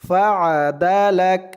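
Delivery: ফাআদালাক with the lam and kaf joined as one syllable, lak; ফাআদালাক said slowly syllable by syllable, ending on a clear lak; Quran recitation demo. Shown as a demonstration. Teacher demonstrating ফাআদালাক for students, careful pronunciation. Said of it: "লাম দিয়ে কাফ ধরলে যেমন উচ্চারণ হয় তেমন উচ্চারণ হবে".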